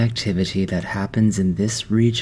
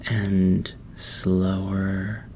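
progressively slower and quieter, with long pauses; slowing right down; 5 dB quieter, much slower than usual. Acted. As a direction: draw each phrase out more, easing into calm. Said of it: "changing from a hurried pace to a slower".

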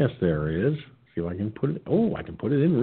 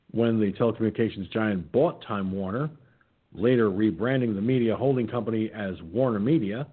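normal talking voice

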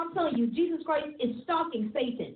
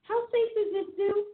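higher-pitched, mock-nervous questioning; a little scared; 8 semitones higher than usual. Zoo visitor starts doubting the lion's cage, a little scared. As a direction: play up a touch of worry. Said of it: "it was a little scary".

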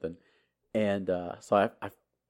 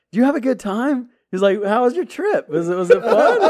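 high, loud, mock-friendly taunting; sarcastic sergeant; 11 semitones higher, 7 dB louder than usual. Drill sergeant mocking the soldier's trip, high sarcastic taunt. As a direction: play nice while plotting.

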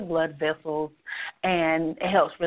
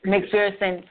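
ordinary talk, nothing marked

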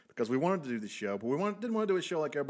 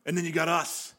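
wrestling promo; 5 semitones higher than usual. Acted. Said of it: "promo, uh, cut one".

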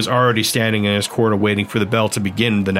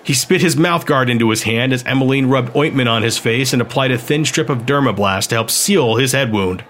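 ordinary delivery

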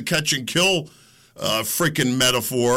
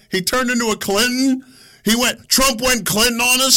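higher, rising disbelief; can't believe it; incredulous; 9 semitones higher, 3 dB louder than usual. Real, not acted. Incredulous outburst.

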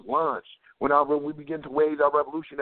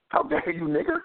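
mock outrage; 10 semitones higher than usual.

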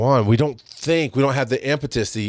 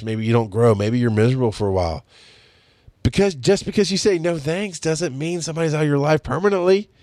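ordinary delivery

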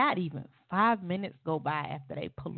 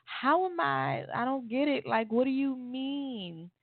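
higher and slow, bewildered; confused pleading; 8 semitones higher, slower than usual. Retold or imitated. To her accusing boyfriend, slow and bewildered. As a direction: stretch it out, lost and confused. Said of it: "I'm like".

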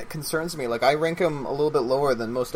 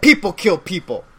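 movie line quote; 3 semitones higher, 4 dB louder than usual. Acted. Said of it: "That was from Romeo Must Die".

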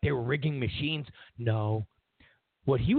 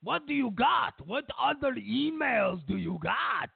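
put-on high, eager comic Russian accent; Vladimir Putin; 7 semitones higher than usual. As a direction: demand it in a hammed-up Russian accent. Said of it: "you don't do a good Russian accent".

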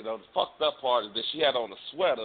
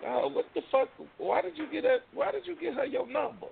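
high, incredulous protest; friend's voice, high and disbelieving; mock disbelief; 13 semitones higher than usual. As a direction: act appalled at your friend's choice. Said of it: "you kept looking at me like".

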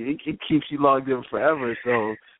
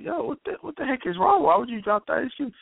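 baffled; 11 semitones higher than usual. Retold or imitated. Confused boyfriend retells reaction.

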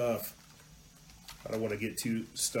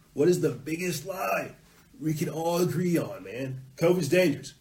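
higher-pitched mocking plotter; put-on conspirator voice, pitched up; mock scheming; 4 semitones higher than usual. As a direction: act out a plotter pitching an idea. Acting out schemers choosing a lie.

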